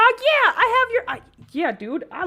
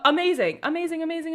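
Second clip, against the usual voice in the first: excited; 8 semitones higher, 4 dB louder, faster than usual. Real, not acted. Repeating amazing.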